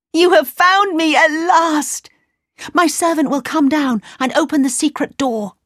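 happy relieved princess; excited. Acted. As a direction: speak with relief. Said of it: "she cried".

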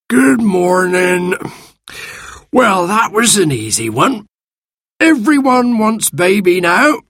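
a smug little magic creature; sand fairy. Acted. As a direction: sand fairy greeting the children, smug and slow.

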